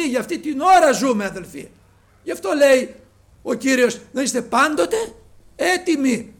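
loud, high, fervent preacher voice; preaching at full force. Acted. Preacher exhorting the brothers, loud and fervent. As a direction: preach it out to the congregation.

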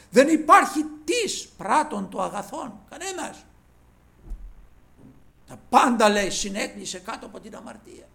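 loud, high fervent declaration, peaking on πάντες; preaching at full force. Acted. Preacher warning the congregation all have sinned, fervent loud preaching. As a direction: thunder out that nobody is righteous.

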